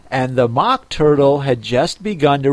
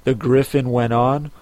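ordinary delivery

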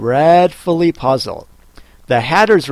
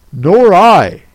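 louder March Hare quip; 5 semitones higher, 8 dB louder than usual. Acted. March Hare chiming in after the Hatter, louder. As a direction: blurt it out. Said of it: "said the March Hare".

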